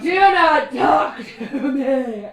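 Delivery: loud, slow, spitting shout; furious shout. Acted. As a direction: bark it at him, spittle flying. Priest yells at customer.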